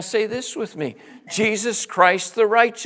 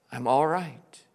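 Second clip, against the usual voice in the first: ordinary talk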